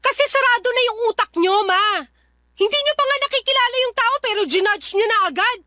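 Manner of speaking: heated retort. Acted. Daughter defending her boyfriend to her mother, heated and fast. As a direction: fire it back at her without a breath.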